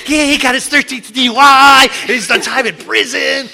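lightly voiced proud girlfriend, high and loud; codependent girlfriend. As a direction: gush it like a proud partner. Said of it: "She was like".

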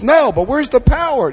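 high, loud mock protest; mock complaint. Complainer answers the question about steps, mock protest. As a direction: push back like a whining complainer.

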